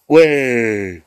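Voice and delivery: loud "Ouais!" of victory; triumphant cheer. Real, not acted. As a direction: shout it, you got it right. Cheers a right answer.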